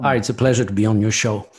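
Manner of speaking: a Quebecer's mock radio announcer English; radio host voice. Shown as a demonstration. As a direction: put on the polished radio voice. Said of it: "une voix d'animateur de radio".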